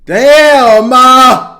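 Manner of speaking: loud, stunned outburst; real shocked holler; shocked shout. Real, not acted. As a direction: yell it in disbelief. Shocked reaction to the video.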